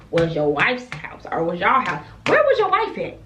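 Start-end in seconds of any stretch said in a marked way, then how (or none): 2.2-3.2 high, pointed accusing question; incredulous callout. Real, not acted. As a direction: demand it with raised pitch. Calling out the married man.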